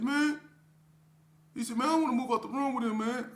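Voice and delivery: high, fast pleading cellmate voice; a jittery young inmate begging to switch rooms; voiced cellmate. Imitating the cellmate begging to switch cells, high and fast.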